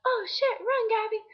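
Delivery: high, loud mock panic; retold alarm. Girl urging friend to flee, alarmed. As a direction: cry out an acted urgent warning.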